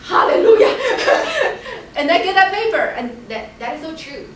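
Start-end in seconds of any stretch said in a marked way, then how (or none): 0.0-3.0 high, loud, drawn-out laughing hallelujah; student hearing her score, high joyful laugh. Retold or imitated. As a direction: burst out in relief and laugh. Relieved at hearing good news.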